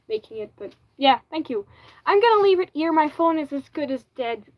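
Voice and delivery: normal talking voice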